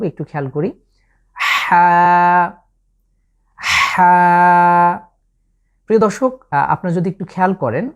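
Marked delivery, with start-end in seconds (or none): 1.4-5.0 হা pressed from mid throat, louder; throaty Arabic ha. Shown as a demonstration. Teacher shows viewers the throaty letter ha. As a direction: squeeze হা out of the throat. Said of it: "কণ্ঠকে খুব চাপ দিয়ে আমরা উচ্চারণ করব".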